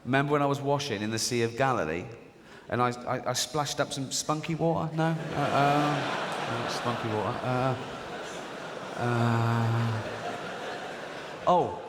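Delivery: flustered Mary. Acted. Acted Joseph and Mary dialogue.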